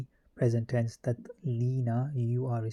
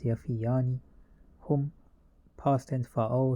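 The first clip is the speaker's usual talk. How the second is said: ordinary delivery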